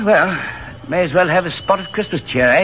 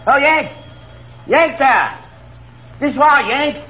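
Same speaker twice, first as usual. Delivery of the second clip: calling out; 9 semitones higher, slower than usual. Acted. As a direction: hail the Yank from the carriage. Calling a soldier over.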